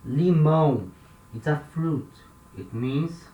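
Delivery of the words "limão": nasal ão ending on limão, "lee-MOWN"; Portuguese word. Shown as a demonstration. Teacher modelling limão for students. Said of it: "we have this word here".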